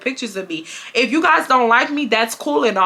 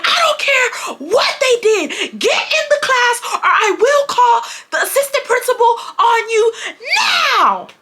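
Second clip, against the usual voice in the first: mock teacher scream, much higher, laughing through it; 13 semitones higher than usual. Teacher screaming at her to get in class, mock scream. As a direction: scream the threat like a furious teacher. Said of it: "this man starts screaming at me more".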